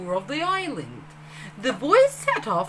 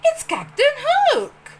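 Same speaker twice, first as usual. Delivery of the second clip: alarmed cry, much higher and louder on Hook; 12 semitones higher, 8 dB louder, slower than usual. Acted. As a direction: shout the warning, pitch leaping up. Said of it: "he cried".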